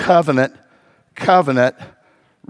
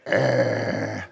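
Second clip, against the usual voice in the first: low, guttural imitated growl; dog growl; 8 dB quieter than usual. Pastor imitating the rescue dog growling at him, low mock growl. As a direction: growl like a wary terrier baring its teeth. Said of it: "He'd show me his teeth".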